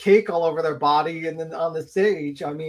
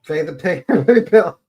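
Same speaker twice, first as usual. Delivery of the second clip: laughing; 7 dB louder than usual. Real, not acted. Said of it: "if there's any multimillionaires".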